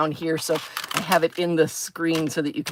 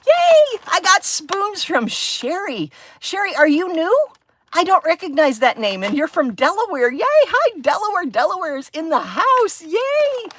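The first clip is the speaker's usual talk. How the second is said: excited greeting; 11 semitones higher than usual. Real, not acted. Greeting new viewer from Delaware.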